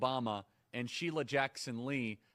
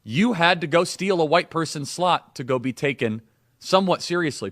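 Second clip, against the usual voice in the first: much louder, hammering each phrase; loud outraged read; 14 dB louder than usual. Acted. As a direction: blast the words out in disbelief. Reading Charlie's claims with outrage.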